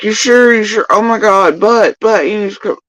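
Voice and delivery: high, loud mock pleading; pleading disbelief. Friends pleading that he come along, high mock pleading. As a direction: beg him to come in a high voice. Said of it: "They're like".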